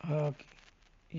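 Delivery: ہا drawn out very slowly; slow h sound. Shown as a demonstration. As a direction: stretch ہا long and clear as a teaching model. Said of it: "یعنی".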